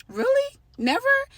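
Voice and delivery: high, loud mock disbelief; incredulous. Partner answering a claim she never cleans, high-pitched incredulity. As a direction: squeak it out in disbelief at the accusation.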